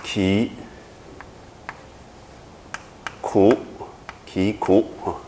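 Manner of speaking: ki and 苦 said apart, each alone and hard; syllable by syllable. Shown as a demonstration. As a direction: split ki and 苦 slowly. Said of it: "我们这个要念作".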